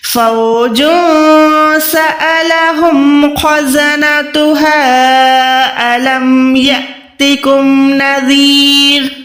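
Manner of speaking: slow melodic tajweed recitation of the verses; Quran recitation. Shown as a demonstration. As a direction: chant the whole verse slowly, drawn out. (धीमी तिलावत)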